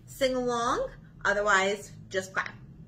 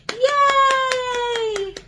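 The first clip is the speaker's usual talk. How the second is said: high, loud happy cheer; 9 dB louder than usual. Acted. Librarian cheering the children after counting, excited. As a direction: celebrate like a kid who got it right.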